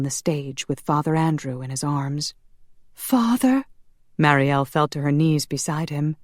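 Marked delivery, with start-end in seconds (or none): 2.9-3.6 high-pitched, distressed question; anxious cry. A narrator's reading. Marielle reaches the wounded priest, anxious cry. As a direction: call out to him, pitched high.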